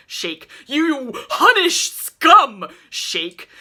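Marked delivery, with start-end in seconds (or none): 0.7-2.7 furious high, loud, slow insult; enraged bellowed insult; abusive insult. A narrator's reading. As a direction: hurl it while shaking him. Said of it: "a lurid assortment of abusive epithets".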